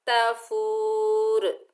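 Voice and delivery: तफूर with a short ta, slow; slow recitation. Shown as a demonstration. Teacher reciting तफूर for the class. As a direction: recite तफूर slowly like tajweed. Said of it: "बगैर खींचे पढ़ेंगे ता".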